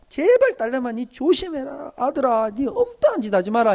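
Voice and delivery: high, slow, begging plea in Gyeongsang dialect; pleading pastor. Retold or imitated. Pastor pleading with his youth group, high and slow. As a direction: beg them like an anxious father. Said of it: "해놨는데".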